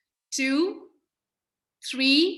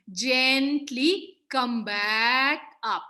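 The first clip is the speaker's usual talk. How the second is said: slow cue; much slower than usual. Real, not acted. Yoga cue, drawn out.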